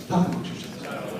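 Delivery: "Talk amongst yourselves" in a whisper. Shown as a demonstration. MC cueing a chatty crowd, whispered. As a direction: breathe the line out unvoiced. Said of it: "If you guys are all talking".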